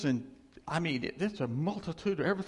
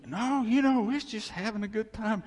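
high, light brush-off; making excuses; 6 semitones higher, 3 dB louder than usual. Acted. People excusing themselves to God, high and dismissive. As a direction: wave the charge away.

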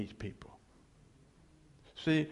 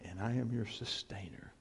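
hushed whisper; 3 semitones lower, 7 dB quieter than usual. Acted. Voicing God's promise.

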